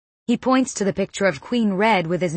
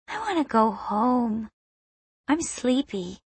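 put-on higher, softer childlike whine; sleepy little girl; 3 semitones higher, 4 dB quieter than usual. A narrator's reading. Tired girl begging to go home, soft whine. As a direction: sound like a tired five-year-old. Five-year-old girl speaks.

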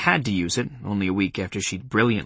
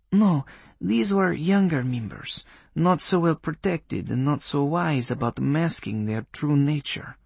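put-on Polish accent; 5 semitones higher than usual. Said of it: "in a Polish accent".

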